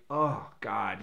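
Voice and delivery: ordinary talk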